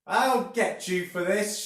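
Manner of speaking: high, loud, slow witchy threat; shouted threat. A narrator's reading. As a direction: shout a vengeful promise from above. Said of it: "shouted Missus Twit".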